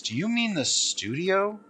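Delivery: ordinary talk, nothing marked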